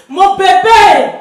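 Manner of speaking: slow, loud cry of grief; stretched keening for the baby; wailing lament. Acted. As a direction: draw out the mourning wail. Mother mourns her dead child.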